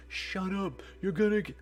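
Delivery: put-on high-pitched child voice; scolding kid. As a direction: shush the others as an older kid. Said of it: "and the other kid's like".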